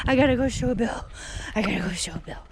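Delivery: breathless, real excitement; thrilled, dropping into a whisper; eager, then hushed with excitement; excited. Real, not acted. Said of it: "I'm excited".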